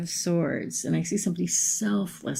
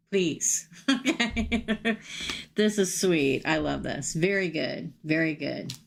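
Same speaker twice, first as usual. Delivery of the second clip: usual speaking voice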